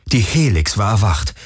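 plain reading voice

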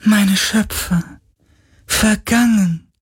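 very high, slow spirit wail; grief-stricken otherworldly lament. A narrator's reading. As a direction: mourn in drawn-out high tones. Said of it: "klagte der Geist".

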